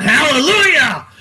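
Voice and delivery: soaring high, loud Hallelujah!; cried out. Retold or imitated. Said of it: "I cried out".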